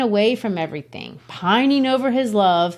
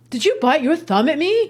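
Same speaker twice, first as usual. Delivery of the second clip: higher, mock offended challenge; 8 semitones higher than usual. Teacher sums up the pointless feud with a mock challenge. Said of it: "They're just".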